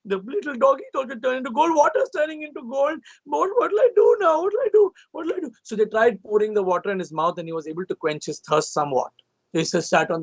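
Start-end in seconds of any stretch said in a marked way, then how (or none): 0.0-5.5 high, panicked wailing; frantic, begging for an answer; king frantic over the gold; freaking out. A narrator's reading. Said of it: "He was freaking out".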